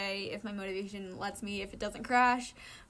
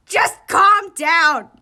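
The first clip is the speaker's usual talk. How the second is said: slow, loud, stretched yell; slow loud shout; 7 semitones higher, 19 dB louder, much slower than usual. Acted. Iwazumi yelling at Okawa to stop, loud. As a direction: drag it out firmly. Chasing panicking friend.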